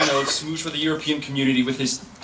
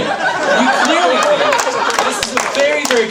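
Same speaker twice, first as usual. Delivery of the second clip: slow, high and loud, milking the word very; stretched-out high emphasis; 11 semitones higher, 7 dB louder, slower than usual. Acted. Debater hamming it up to the room, slow and high. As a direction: drag it out for the laughing audience.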